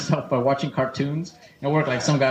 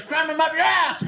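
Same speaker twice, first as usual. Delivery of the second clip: mock yell; 11 semitones higher than usual. Barfly girlfriend raging at dinner.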